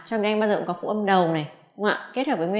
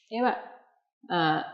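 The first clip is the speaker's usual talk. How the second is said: normal talking voice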